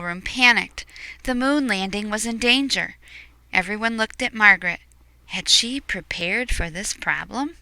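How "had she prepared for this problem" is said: usual speaking voice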